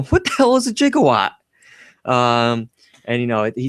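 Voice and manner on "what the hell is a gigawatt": much higher, panicked mock Marty; high, frantic imitation of Marty; imitating Marty. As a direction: shriek the question in disbelief. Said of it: "Marty going".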